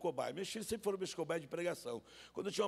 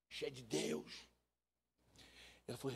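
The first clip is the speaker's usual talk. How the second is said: usual speaking voice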